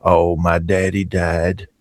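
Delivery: imitating Max's low, slow voice on the phone; low mournful old man. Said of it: "And he said".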